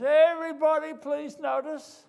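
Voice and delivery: town crier shouting high and loud; loud call. Acted. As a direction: call it out to the whole city. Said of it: "call out in a loud voice".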